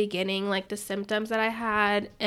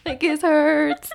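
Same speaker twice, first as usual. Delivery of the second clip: put-on whiny crying kid; 6 semitones higher, 4 dB louder than usual. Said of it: "crying to my mom".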